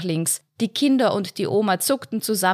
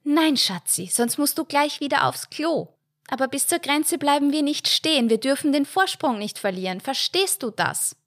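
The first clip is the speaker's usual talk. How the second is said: bossy mother; 8 semitones higher than usual. A narrator's reading. Mother voiced in story.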